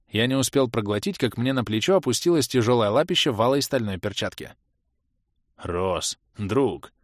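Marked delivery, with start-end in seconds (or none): 5.6-6.9 heartfelt bass. A narrator's reading. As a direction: rumble it soulfully to a friend. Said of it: "проникновенно пробасил Барс".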